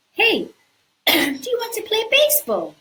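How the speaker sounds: high, loud eager invitation; cheerful giant kid. Acted. Giant inviting Jack to play, high and eager. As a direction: ask it brightly like a kid.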